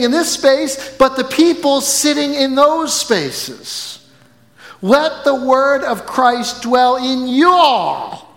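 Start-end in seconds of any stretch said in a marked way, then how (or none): 4.8-8.3 slow, stiff "you all" in two separate words; awkward "you all". Shown as a demonstration. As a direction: force out "you all" like it feels wrong. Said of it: "I can't even say it right".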